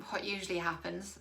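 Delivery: usual speaking voice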